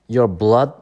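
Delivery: ordinary talk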